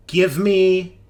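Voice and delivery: ordinary talk, nothing marked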